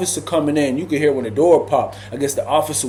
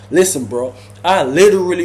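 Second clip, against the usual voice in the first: normal talking voice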